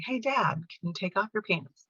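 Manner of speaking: patient, hushed request to a parent; gentle coaxing. Retold or imitated. Daughter standing in the doorway to dad, gently coaxing. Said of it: "I would just gently say".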